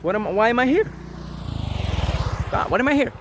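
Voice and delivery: high, loud, searching plea to the sky; pleading seeker. Pretend. Seeker crying out to God, high and loud. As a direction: beg the heavens for an answer. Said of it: "you ask that".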